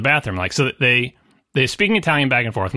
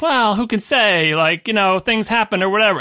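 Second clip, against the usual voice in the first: mocking shrug; 6 semitones higher than usual. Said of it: "And he's like".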